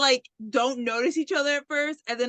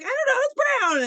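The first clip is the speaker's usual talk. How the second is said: much higher flustered quote; mock panic; 12 semitones higher, 4 dB louder than usual. Woman answering partner about the bear, mock-panicked. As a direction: imitate a flustered woman, voice shooting up.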